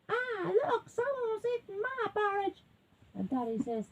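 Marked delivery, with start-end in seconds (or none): 0.1-2.6 very high, small bear whine; upset little bear. A narrator's reading. Little bear finding porridge eaten, whining high. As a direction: squeal it high like an upset cub. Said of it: "And the little bear says".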